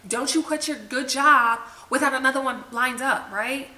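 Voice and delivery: mock-stern inner warning, lightly voiced; nagging inner voice. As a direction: imitate the voice warning you not to quit. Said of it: "you hear it in your head".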